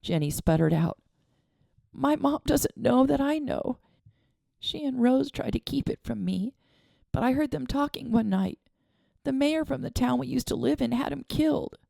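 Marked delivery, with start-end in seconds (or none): 1.9-11.8 put-on raised girlish voice, confiding a hurt; a young girl spilling a secret; tearful girl's voice. A narrator's reading. Girl telling her friend about her dad, tearful. Jenny confides through tears.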